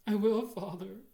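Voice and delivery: bursting with relief; joyful. A narrator's reading. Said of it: "with something approaching joy".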